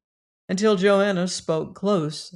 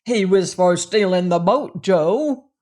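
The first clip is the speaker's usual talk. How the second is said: an emphatic, louder charge; loud accusation; 7 dB louder than usual. A narrator's reading. Man accusing another of stealing the boat, loud accusation. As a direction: tell on him loudly and firmly.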